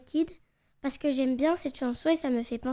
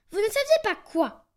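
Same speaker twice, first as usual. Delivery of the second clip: très énervé; 5 semitones higher, 5 dB louder than usual. Acted. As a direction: question sèche et forte. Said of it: "décidément très énervé". (loir énervé, plus fort)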